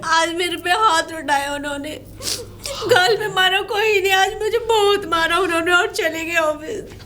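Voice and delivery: tearful account, breaking into sobs; sobbing complaint; crying. Acted. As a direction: pour out the hurt while crying. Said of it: "लेकिन रो क्यों रही हो?".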